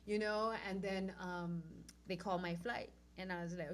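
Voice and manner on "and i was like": ordinary delivery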